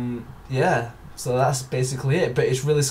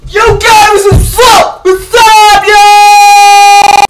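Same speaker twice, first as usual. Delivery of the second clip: high, loud shouted greeting; over-the-top shouted YouTuber intro; hammed-up hype intro; 15 dB louder, slower than usual. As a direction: bellow it like a hype man.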